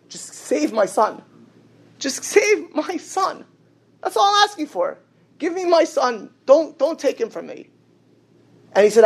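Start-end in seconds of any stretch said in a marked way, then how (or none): 0.1-7.7 desperate retold prayer; pleading.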